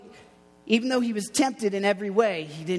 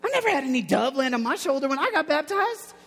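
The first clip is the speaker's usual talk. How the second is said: higher-pitched, acted disbelief; mock amazement; 6 semitones higher than usual. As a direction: marvel like an envious onlooker. Imagined disciple amazed at the dove.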